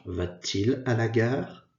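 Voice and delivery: "Va-t-il" said slowly with a clear added T sound between "Va" and "il"; linking T sound. Shown as a demonstration. Teacher slowly shows students the linking T in "Va-t-il". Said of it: "on ajoute un T pour la prononciation".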